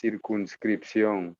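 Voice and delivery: slow, deliberate Circunscripción; slow and careful. Shown as a demonstration. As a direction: spell out Circunscripción slowly. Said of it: "Just say that first word for me again".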